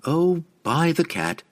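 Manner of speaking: high, slow and eager; excited. A narrator's reading. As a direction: gush with delight. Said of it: "the other agreed excitedly".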